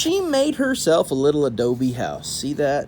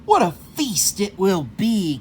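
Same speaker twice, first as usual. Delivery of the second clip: lightly voiced coyote gloating to himself; 5 semitones higher than usual. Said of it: "Coyote said to himself".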